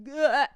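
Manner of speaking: loud dumb climax grunt; mock orgasm grunt. Imitating guys climaxing, loud dumb grunt. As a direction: grunt like a dopey guy finishing. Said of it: "dumb cum face".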